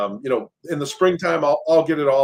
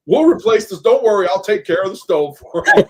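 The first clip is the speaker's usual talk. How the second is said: higher, louder hammed-up salesman pitch; imitating the guy eager to sell the stove; mock salesman; 8 semitones higher, 9 dB louder than usual. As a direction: oversell it like a pushy contractor.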